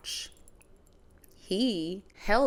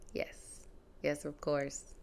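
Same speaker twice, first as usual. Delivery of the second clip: hushed agreeing; 8 dB quieter, slower than usual. Retold or imitated. Humoring brother in hospital.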